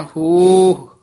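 ਹੋਹ with a lengthened final ਹ, not ਹੋ ਹੋ; ਹੋਹ said long and loud at the end; ਹੋਹ said right. Shown as a demonstration. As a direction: stretch the ending of ਹੋਹ out. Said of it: "ਇਹ ਹੋਹ ਉਚਾਰਨ ਕਰਨਾ ਹੈ, ਹੋ ਹੋ ਨਹੀਂ ਬੋਲਣਾ".

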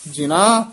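ਜਿਨ੍ਹਾਂ as "jina", light single n; loose, unpressed. Shown as a demonstration. Teacher correcting the word, ਜਿਨ੍ਹਾਂ said loosely. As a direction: say ਜਿਨ੍ਹਾਂ loosely, n not doubled. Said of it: "ਪੋਲਾ".

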